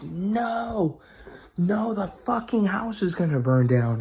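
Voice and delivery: high, drawn-out cry of NO; retold panic. Storyteller relives spotting the kitchen fire, alarmed.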